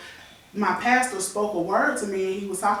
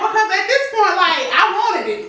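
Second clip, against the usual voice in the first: high, loud laughing confession, much higher and louder; laughing loudly; 9 semitones higher, 9 dB louder than usual. Real, not acted. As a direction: laugh it out while admitting it. Laughing, teased about tempting him.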